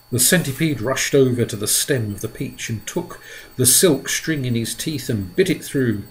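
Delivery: ordinary delivery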